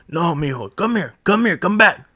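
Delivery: high, loud "Come here" calls; uncle calling back. Retold or imitated. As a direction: summon the kid back firmly.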